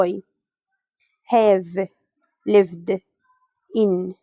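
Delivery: each word set apart with long pauses; word-by-word dictation. Shown as a demonstration. Teacher dictating to students, each word separated by pauses. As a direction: spell the sentence out word by word for students. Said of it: "يعني أنا هقول له".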